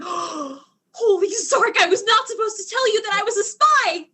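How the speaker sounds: realizing the slip in panic; panicked blurt. Acted. Alien blurts out own secret.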